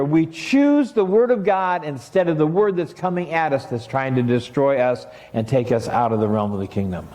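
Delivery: normal talking voice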